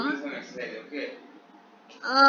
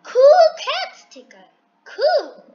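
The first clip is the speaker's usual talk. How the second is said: excited; 8 semitones higher, 8 dB louder than usual. Real, not acted. Excited at sticker.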